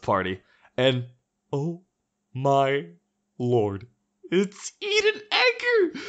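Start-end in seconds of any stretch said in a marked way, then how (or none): 1.5-6.0 mock thrilled. Mock delight at meeting Eden.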